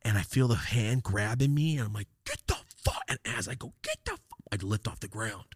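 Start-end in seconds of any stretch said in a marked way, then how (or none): 2.3-3.0 strained, very high squawk; retold high-pitched outburst; angry protest. Acting out being grabbed. 3.8-4.2 strained, very high squawk; retold high-pitched outburst; angry protest. Same cry while being lifted.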